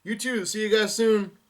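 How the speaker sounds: high, loud goodbye call; yelled farewell. A narrator's reading. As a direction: call out to friends as you leave. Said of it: "And Luffy yells out".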